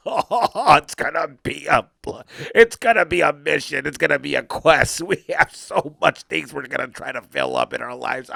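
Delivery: laughing villain proclamation; hammed-up evil laughter; maniacal alter ego. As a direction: declare a grand quest between villain cackles. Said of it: "a different personality".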